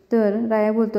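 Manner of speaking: usual speaking voice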